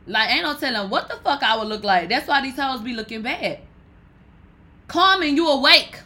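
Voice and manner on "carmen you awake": loud, high call across the room. Real, not acted. Calling across the room to see if Carmen is awake, loud. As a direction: shout over to check she's up.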